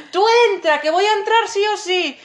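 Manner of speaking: high, loud yell; shouting. Retold or imitated. [name] yelling to Andrea over the crowd, high loud. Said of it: "le grité".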